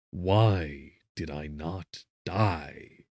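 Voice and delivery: plain reading voice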